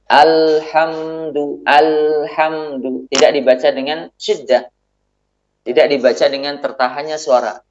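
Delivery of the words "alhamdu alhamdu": very slow "alhamdu", the lam flowing a little, not cut off; slight flow on lam. Shown as a demonstration. Teacher modelling the right lam for students, very slowly. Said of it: "Suaranya harus mengalir, namun sedikit".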